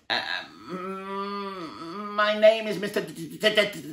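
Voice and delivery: high, stuck stammer on D; nervous stammer. A narrator's reading. Mister Jelly telling the tramp his name, high nervous stammer. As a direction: stutter over your own name.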